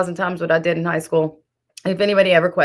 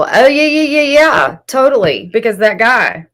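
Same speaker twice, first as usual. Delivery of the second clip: sarcastic; 5 semitones higher, 6 dB louder than usual. Real, not acted. Sarcastic agreement with chat.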